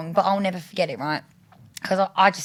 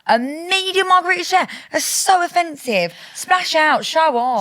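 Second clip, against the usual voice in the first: high-pitched, comic outrage at a date; indignant; 8 semitones higher, 4 dB louder, slower than usual. Retold or imitated. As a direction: rant in mock offence at his stinginess. Retold outrage at stingy pizza order.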